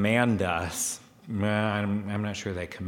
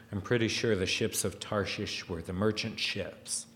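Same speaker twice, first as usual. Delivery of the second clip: ordinary talk, nothing marked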